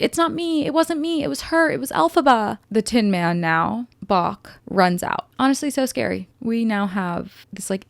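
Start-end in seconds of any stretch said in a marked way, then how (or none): 0.0-2.6 much higher, whiny blaming voice; panicky denial. Retold or imitated. Nessa blaming Elphaba to Boq, high and panicky. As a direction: squeak out the excuse fast to shift blame. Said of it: "Nessa immediately is like".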